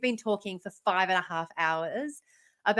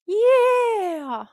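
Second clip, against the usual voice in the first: shout; 11 semitones higher, 6 dB louder than usual. Real, not acted. Wrapping up the stream.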